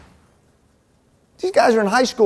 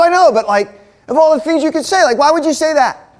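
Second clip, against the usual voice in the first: high, loud exasperated protest; mad protest; 8 semitones higher, 7 dB louder than usual. Retold or imitated. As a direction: act out an indignant man who won't let it go. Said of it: "He got really mad at me".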